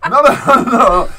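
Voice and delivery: high, loud retold protest; insistent reassurance. Son stopping mom's apology over his tattoo, loudly insistent. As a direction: wave off an apology emphatically.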